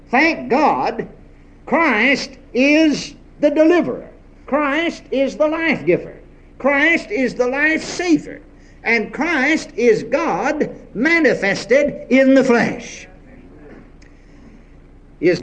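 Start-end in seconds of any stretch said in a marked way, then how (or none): 1.7-13.1 higher, slow, ringing proclamation; preaching at full force. Acted. Preacher proclaiming Christ to the congregation, slow and ringing. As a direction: lift each line up like a declaration.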